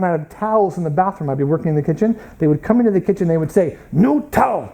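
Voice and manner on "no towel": higher, abrupt mock demand; imitated yell. Korean students demanding towels in the kitchen, imitated yell. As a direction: imitate a curt yelled demand. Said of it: "they would yell at me".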